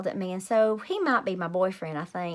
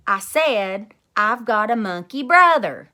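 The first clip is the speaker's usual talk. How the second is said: much louder, insistent shout; 10 dB louder, slower than usual. Acted. Junie B shouting to teacher so she hears, much louder. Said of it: "I shouted real louder".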